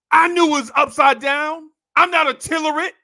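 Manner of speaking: high, loud indignant shout; outraged yell. Retold or imitated. Keisha yelling at her date in a full restaurant. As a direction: blurt it out high and loud, offended.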